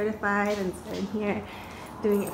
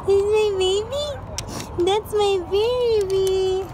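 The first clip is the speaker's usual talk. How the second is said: high, loud, drawn-out squeal; overjoyed; 10 semitones higher, 9 dB louder than usual. Real, not acted. Reunion with the groomed dog.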